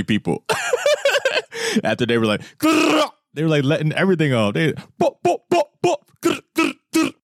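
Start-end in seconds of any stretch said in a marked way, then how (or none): 2.6-3.1 mock gun growl. Imitating gang firing guns. 5.0-7.1 high mock gunfire noises bop and grrr; imitated gunfire.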